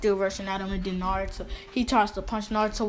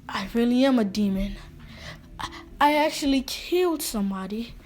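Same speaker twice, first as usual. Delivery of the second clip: a touch of horror, louder and rising in pitch; halting, mildly acted shock that climbs higher; horrified realization; 3 semitones higher, 3 dB louder than usual. Naruto realizing he killed somebody, mildly horrified.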